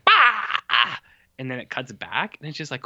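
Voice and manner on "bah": high silly "Bah!" in a changed voice; mock villain yell. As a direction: bark it like a cartoon villain. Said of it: "Voldemort's like".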